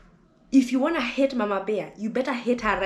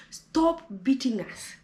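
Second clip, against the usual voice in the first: normal talking voice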